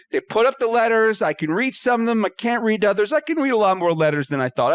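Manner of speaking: ordinary delivery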